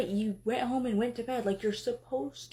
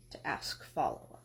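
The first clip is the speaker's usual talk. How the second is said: bitter whisper; 11 semitones lower, 5 dB quieter than usual. Acted. Hurt husband's bitter jab.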